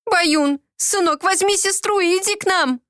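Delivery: high, pleading call; a hint of a sob; sobbing plea. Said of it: "всхлипнула Катерина".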